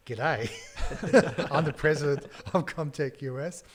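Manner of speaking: loud, broad Australian twang through a laugh; thick Australian accent. Retold or imitated. Boss answering the agent's call, thick Australian accent. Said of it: "in a very thick Australian accent".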